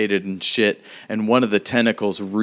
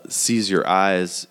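ordinary talk

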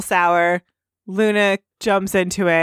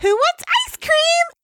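very high, slow sing-song offer; Pinkie Pie voice. Acted. Podcaster as Pinkie Pie offering ice cream, high and sing-song. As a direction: call it out like a party pony.

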